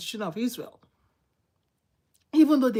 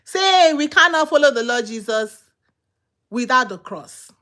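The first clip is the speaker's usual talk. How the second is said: call to repeat; 5 dB louder than usual. Real, not acted. Preacher telling the congregation to say after him, loud call. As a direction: lead the congregation in a loud declaration. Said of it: "Say after me".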